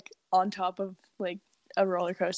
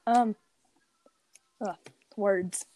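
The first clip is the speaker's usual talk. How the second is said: tongue-tied. Real, not acted. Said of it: "I'm having trouble speaking".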